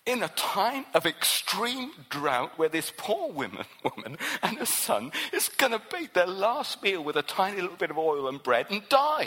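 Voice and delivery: ordinary delivery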